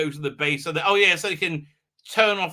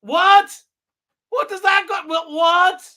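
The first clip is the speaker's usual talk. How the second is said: high, loud incredulous what; baffled outburst at the line; real disbelief; 14 semitones higher, 10 dB louder, slower than usual. Real, not acted. Fan reacting to the mum line, high loud disbelief. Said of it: "you should see my mum".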